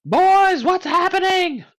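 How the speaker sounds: loud high call, slow; shouting. Acted. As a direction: bellow up the cliff to the boys. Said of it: "shout at the top of my lungs".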